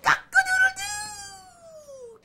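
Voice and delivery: very high, long-held crowing; stretched cock-a-doodle-doo; rooster crow. Acted. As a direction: crow it out with a piercing tail. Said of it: "his manner changes, and he goes".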